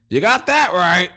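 high, loud, drawn-out agreement; emphatic agreement. Pretend. Co-host joking about coming home to buttons, emphatic and drawn out. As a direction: draw it out big and high.

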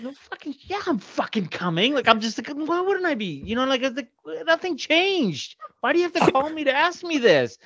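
high-pitched exasperated rant; retold outburst, voice climbing way up; angry, belligerent. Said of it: "I'm just angry".

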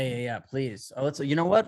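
ordinary talk

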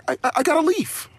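high stammering put-on voice; high childish stammer; mock wife as toddler. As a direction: mimic her like a one-year-old with a leaf. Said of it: "She's like".

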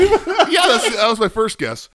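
high, loud words broken by laughter; laughing. Real, not acted. A co-host admitting his guess while laughing, high and loud. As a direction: laugh through the admission.

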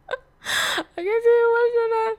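breaking down mid-sentence, pitch cracking up; crying. Real, not acted. Retelling her broken engagement, she breaks into tears. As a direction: voice breaking high into real tears. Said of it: "Oh my God, I'm getting emotional".

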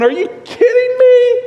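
incredulous apostles. Retold or imitated. Apostles react to Mary's perfume with high disbelief. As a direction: gasp it out as if you can't believe your eyes.

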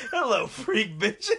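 loud, high, laughing hello; loud mock greeting. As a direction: burst in greeting the class loudly. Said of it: "I'll walk in there".

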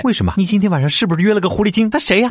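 put-on jealous girlfriend voice.

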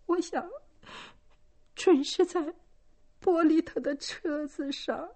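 high, shaky frightened answer; trembling. A narrator's reading. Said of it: "激动得浑身发抖". (浑身发抖地低声回答)

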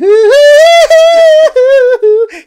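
long, very high, loud sustained wail; high song howl. Shown as a demonstration. Host mimicking the start of that song, high howl. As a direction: hold one piercing falsetto-like note. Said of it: "doing the beginning of that song".

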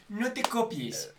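slow, sly warning; drawn-out, teasing; mock friend warning. Friend lending homework, warning slowly. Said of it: "la tarea del amigo que es".

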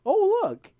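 very high, loud cartoonish exclamation; hammed-up high-pitched callout; voicing a discovery. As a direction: squeal it out in mock amazement. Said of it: "and they're like".

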